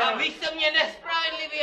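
high, loud complaint; the wounded man shouting at the instructor; shouted protest. Pretend. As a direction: yell your protest.

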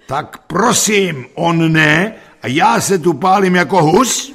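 high, very loud indignant yell; roaring. A narrator's reading. School caretaker with a burning briquette on his head, roaring. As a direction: roar it out in protest. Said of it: "a řval".

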